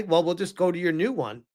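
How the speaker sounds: ordinary delivery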